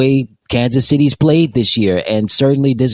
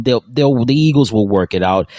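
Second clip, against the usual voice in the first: ordinary delivery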